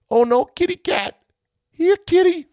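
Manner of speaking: very high, cooing call to a cat; cooing to a kitty. Retold or imitated. Fans calling up to the cat on the wire, squeaky high coo.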